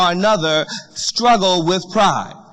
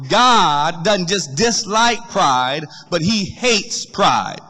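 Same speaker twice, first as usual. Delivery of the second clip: slow weighty contrast; much slower than usual. Acted. Contrast dislike versus hate.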